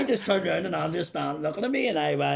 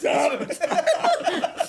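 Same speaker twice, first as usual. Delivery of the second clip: high burst of laughter; 10 semitones higher than usual. Real, not acted. Mate laughs hard at the touching line, high laugh. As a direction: crack up laughing.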